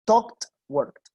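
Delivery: "Talked, worked" each ending in a hard T; one syllable each, crisp final T; explosive final T. Shown as a demonstration. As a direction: spit out the closing T of both words. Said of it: "como una T explosiva".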